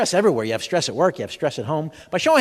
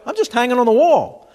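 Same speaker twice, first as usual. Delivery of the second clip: mock spouse reply; 6 semitones higher, 5 dB louder than usual. Spouse voiced as painting.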